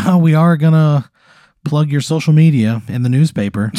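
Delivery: high, loud, gushing small-talk voice; chatty reporter. Acted. Local reporter greets a former classmate, high gushy voice. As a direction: swoop up as an over-friendly interviewer. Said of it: "She goes".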